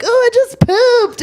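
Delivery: put-on high-pitched, loud toddler boast; mock child's triumphant yell; bragging little kid. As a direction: imitate a kid proudly announcing it. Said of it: "they're bragging about".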